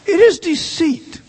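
preaching at full force. Acted. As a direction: drive each phrase home like a revival preacher.

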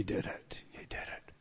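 very soft, low, fading to a whisper; hushed whisper. Real, not acted. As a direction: let the declaration sink into an awed whisper. Climax repeated in a hush.

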